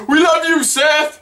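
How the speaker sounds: high-pitched fan call; re-enacted shout. Fan to Seth at the show, high shouted cheer. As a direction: cheer it up to the stage. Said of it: "one time I shout".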